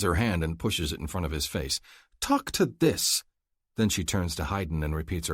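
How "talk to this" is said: sassy dismissal. A narrator's reading. Dismissive gesture at Hassan.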